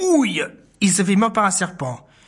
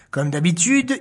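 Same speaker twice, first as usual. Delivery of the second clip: ordinary delivery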